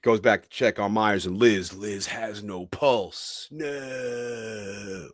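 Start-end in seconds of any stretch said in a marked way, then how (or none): 3.5-5.1 soft, higher, drawn-out mock lament; mock dismay. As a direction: play up the tragedy, softly. Mock dismay at character's death.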